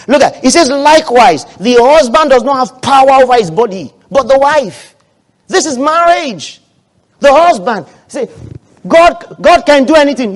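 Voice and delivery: loud, high-pitched sermon proclamation; forceful preaching. Real, not acted. Preacher declaring scripture to the congregation, forceful preaching. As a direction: thunder the verse to the church.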